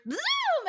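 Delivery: very high, loud zoom sound; playful engine noise; car zoom. Retold or imitated. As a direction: whoosh it like a speeding car. Describing car speeding off.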